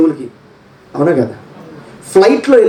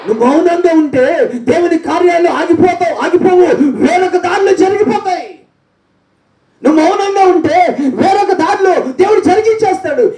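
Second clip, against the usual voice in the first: high, fervent preacher's cry; preaching at full force; 13 semitones higher than usual. Acted. Preacher exhorting the congregation to praise, high. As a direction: drive it home to the church.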